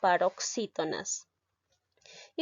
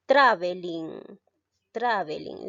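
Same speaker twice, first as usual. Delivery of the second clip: "traveling" as TRA-ve-ling, drawn out; slow demo of "traveling", weight on its first syllable; stress on first syllable; 2 semitones lower, slower than usual. Shown as a demonstration. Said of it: "La mayor fuerza de voz en este caso".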